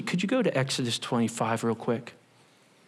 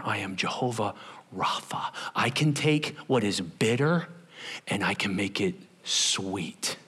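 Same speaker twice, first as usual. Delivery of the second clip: soft, breathy whisper of God; 4 semitones lower, 5 dB quieter than usual. Acted. God speaking to his people, in a whisper. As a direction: whisper it like a sacred secret.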